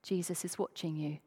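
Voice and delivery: low, hushed voice from the dark; mysterious warning voice. Acted. Unseen voice to a burglar mid-theft, low mysterious warning. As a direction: drop it low and soft, like an unseen watcher. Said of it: "he heard this voice".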